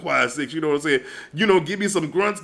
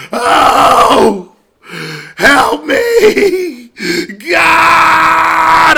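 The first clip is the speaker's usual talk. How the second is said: higher, louder mock anguished cry; hammed-up wail; 10 semitones higher, 9 dB louder, much slower than usual. Retelling a bad hookup, mocking the man's loud cry. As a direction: wail like he's possessed.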